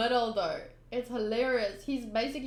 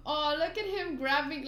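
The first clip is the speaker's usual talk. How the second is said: ordinary delivery, nothing marked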